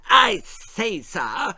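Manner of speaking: ordinary delivery, nothing marked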